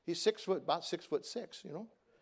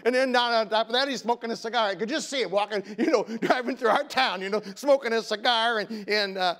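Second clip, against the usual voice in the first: loud, high, exasperated outburst; preaching at full force, aghast; exasperated rant; 8 semitones higher, 10 dB louder than usual. Retold or imitated. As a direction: rail at the memory of his son smoking. Preacher ranting about son.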